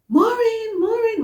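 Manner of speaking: high, drawn-out call to wake a patient; calling her name. Retold or imitated. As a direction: call out her name as if she's far away.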